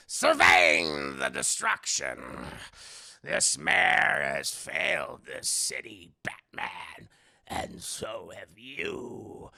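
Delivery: low, slow growling Penguin voice; deep menacing Penguin rasp, drawn out; Penguin impression. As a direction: rumble it low and slow like a villain. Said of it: "He's like".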